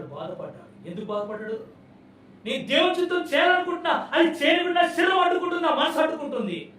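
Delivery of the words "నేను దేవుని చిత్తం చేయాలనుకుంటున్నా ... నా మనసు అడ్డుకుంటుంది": high, loud anguished cry; Paul's lament. Retold or imitated. Preacher voicing Paul crying out over his flesh, high and loud. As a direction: pour out the struggle as a lament. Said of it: "అని పౌలు రోధించాడు".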